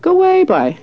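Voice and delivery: imitating an astonished friend; disbelieving exclamation. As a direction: exclaim it way up high, as a friendly brush-off. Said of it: "she said".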